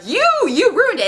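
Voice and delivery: high, swooping outrage; hammed-up indignant cry; mock accusation. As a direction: wail the blame theatrically. Acted accusation after the hit.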